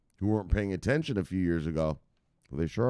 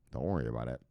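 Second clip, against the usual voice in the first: usual speaking voice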